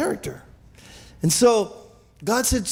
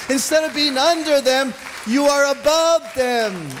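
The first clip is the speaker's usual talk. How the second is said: raised, driving sermon voice; full-force preaching; 5 semitones higher than usual. Acted. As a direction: preach it out to the congregation.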